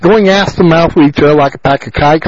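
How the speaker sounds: ordinary talk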